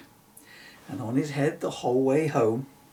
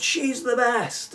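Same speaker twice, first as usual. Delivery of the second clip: cheering crowd; 10 semitones higher, 5 dB louder, slower than usual. Acted. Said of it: "The unicorns cried".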